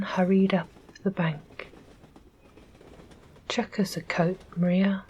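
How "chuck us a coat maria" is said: plain reading voice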